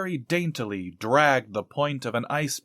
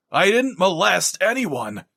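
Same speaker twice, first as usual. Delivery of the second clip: yelled; 6 semitones higher than usual. Acted. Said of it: "Farthing yelled".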